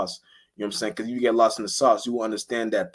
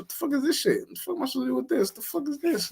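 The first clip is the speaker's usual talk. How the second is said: pitched way up, fast, baffled squeak; high incredulous mock voice; 3 dB quieter than usual. Imitating someone handed a tiny object, high and baffled. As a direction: act baffled by a useless gift. Imitating confused reaction to gift.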